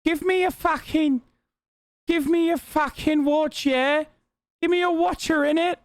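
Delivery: high-pitched mock accented demand; Margot Robbie. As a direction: put on a high, pushy character voice. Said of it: "if she came up to me and was like".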